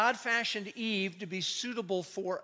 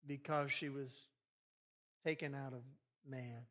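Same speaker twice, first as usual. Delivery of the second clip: dry textbook reading; 4 semitones lower, 6 dB quieter than usual. Shown as a demonstration. Preacher mocking a dull reading, flat and fading. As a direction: read it like a wordy science textbook. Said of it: "we just read it like".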